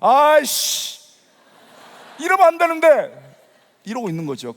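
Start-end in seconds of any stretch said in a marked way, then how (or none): 0.0-3.2 loud, high exclamation of dismay; acted frustration. Unbeliever whose tears keep flowing, frustrated with himself. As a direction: scold yourself, mock-exasperated. Fighting unexpected tears, retold.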